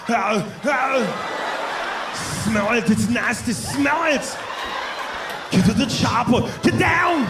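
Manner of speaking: put-on high, shouted Austrian-accent Arnold voice; mock Arnold. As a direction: ham it up as Arnold in a crowded elevator. Elevator fart, acting Arnold.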